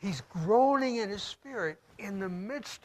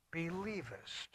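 hushed question; 11 dB quieter than usual. Acted. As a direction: drop to a near whisper on the question. Preacher reads Jesus's question softly.

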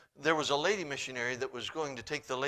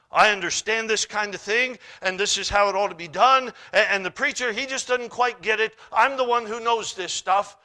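loud, raised know-it-all voice; prideful boasting; 6 semitones higher, 11 dB louder than usual. Acted. Church member who thinks he outknows the preacher, prideful. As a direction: boast emphatically, mimicking a proud member. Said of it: "Pride, that's all that is".

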